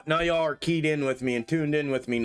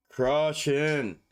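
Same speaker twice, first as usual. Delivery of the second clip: ordinary delivery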